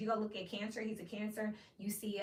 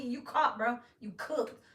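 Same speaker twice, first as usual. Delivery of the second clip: ordinary talk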